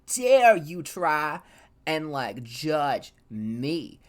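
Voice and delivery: slow indignation with a loud burst on "dare"; retold theatrical outrage, drawn out; mock outraged yell. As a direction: act out a scolding, each word weighed. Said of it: "they yelled and screamed".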